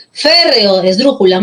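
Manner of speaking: ordinary talk, nothing marked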